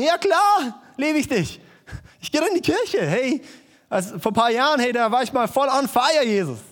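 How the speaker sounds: mock enthusiastic believer brushing off the question; eager glib answer. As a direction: gush it out like a keen churchgoer.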